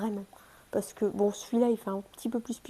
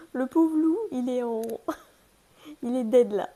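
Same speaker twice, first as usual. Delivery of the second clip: mock pity; 2 semitones higher, 7 dB louder than usual. Real, not acted. Pitying the torn toy wolf.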